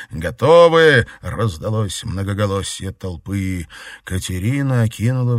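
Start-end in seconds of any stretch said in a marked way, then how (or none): 0.1-1.1 loud unison answer; crowd shout. A narrator's reading.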